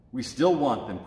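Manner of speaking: plain reading voice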